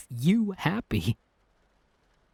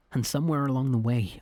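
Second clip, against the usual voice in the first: ordinary talk, nothing marked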